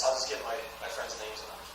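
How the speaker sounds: low, soft aside to himself; reluctant thought. Acted. Trevor deciding against the business, reluctant. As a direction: sink into a reluctant mumble.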